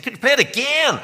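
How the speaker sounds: a rough Northern Irish voice, pitched well up; Northern Irish accent. Retold or imitated. John asks yet again in the edit suite, in a rough Northern Irish accent. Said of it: "forgive me, I can't do the accent, but I'll try".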